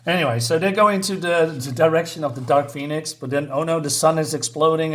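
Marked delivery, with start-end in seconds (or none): none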